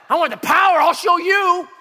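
high, loud, power-hungry mock shout; vengeful shout. Young zealot threatening liberals, vengeful shout. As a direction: belt it out as an overblown threat.

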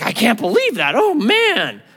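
mock shock. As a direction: overplay being scandalised. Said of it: "not being like".

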